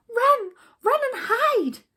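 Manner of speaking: high loud frightened shout; high panicky yell; panicked cry. Acted. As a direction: yell it in terror. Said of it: "they all cried in despair".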